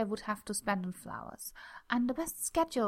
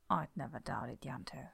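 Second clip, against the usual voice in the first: lowered, hushed and intimate; low whisper; 8 semitones lower, 8 dB quieter than usual. Acted. Lover teasing Yanto, low intimate whisper. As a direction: breathe it low and close.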